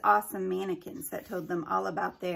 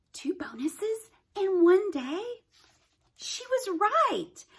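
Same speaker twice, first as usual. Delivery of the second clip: much higher, slow delighted wonder; thrilled disbelief; 11 semitones higher, 4 dB louder, slower than usual. A narrator's reading. Haley marvels at her luck, high and slow. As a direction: savour the surprise. Haley's excited inner thought.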